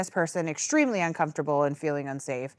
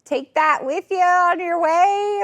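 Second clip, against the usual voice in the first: high, slow, overly polite hint; mock-friendly high hint with a little laugh; fake casual hint; 8 semitones higher, 5 dB louder than usual. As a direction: sweetly nudge him to leave. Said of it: "trying to drop some casual hints".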